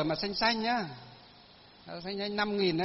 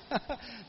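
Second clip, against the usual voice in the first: brief, soft chuckle; a little laugh at your own plight; amused chuckle; 4 semitones higher, 6 dB quieter than usual. Real, not acted. (cười khẽ)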